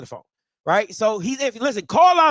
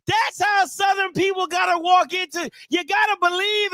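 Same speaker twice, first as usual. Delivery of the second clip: hype shout; 6 semitones higher than usual. Real, not acted. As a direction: fire up the crowd. Hyping Southern's energy.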